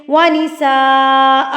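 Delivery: ونساء with the long a drawn out over four counts; stretched madd. Shown as a demonstration. Teacher counting on her fingers, ونساء drawn out long. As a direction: stretch ونساء slowly across four beats. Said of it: "ایک ایک کو کھولتے گئے".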